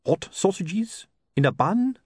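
high, drawn-out hopeless hawker; hopeless street seller's call, rising at the end. A narrator's reading. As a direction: pitch it up and stretch it out hopelessly. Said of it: "A hopeless sort of voice said".

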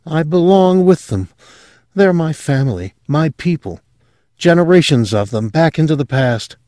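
lightly voiced young woman, high and earnest; confessing her strange family with rising fervour; a hint of a girl gone dreamy. Sylvia voiced in story.

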